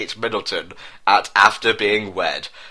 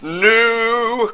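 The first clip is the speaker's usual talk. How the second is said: hammed-up despairing cry, much higher; mock cry; 13 semitones higher than usual. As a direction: wail it as he falls. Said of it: "cried out Prince William".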